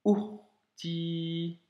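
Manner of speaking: "urti" with a catch before the ou; "urti" said slowly, the ou started by a glottal stop; slow Arabic "urti" with a throat stop on the ou; Arabic word urti. Shown as a demonstration. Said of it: "blocage du ou".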